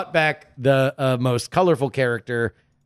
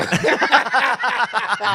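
laughing; 7 semitones higher than usual. Real, not acted. Laughing at teasing.

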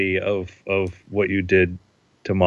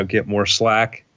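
ordinary talk

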